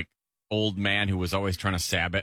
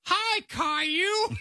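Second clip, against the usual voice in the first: very high, drawn-out fake little-girl squeal; adult faking kid's voice; much slower than usual. Mocking a cartoon's adult-voiced little sister, squeaky fake kid voice. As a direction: imitate a grown-up badly playing a little sister. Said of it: "his little sister was clearly an adult just going".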